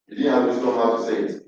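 normal talking voice

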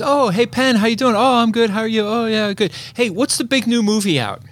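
high, cheery small talk voice; chatty acquaintance. Retold or imitated. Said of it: "somebody in the shop that says".

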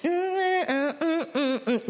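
high-pitched hums easing downward; enjoyment hum. Acted. Worshipper still enjoying the songs, high blissful humming. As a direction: hum along as if lost in the music. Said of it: "we have enjoyed the songs".

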